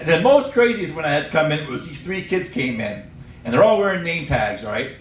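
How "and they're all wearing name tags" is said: usual speaking voice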